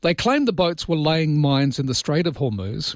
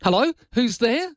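caller's puzzled question; 11 semitones higher than usual. Retold or imitated. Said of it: "answering machine caller".

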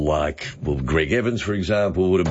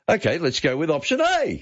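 the whole line pitched much higher; "let's go with option A" said way up high; upward inflection; 9 semitones higher than usual. Shown as a demonstration. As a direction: push the entire line up high, literally. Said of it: "Now go upward inflection at the end".

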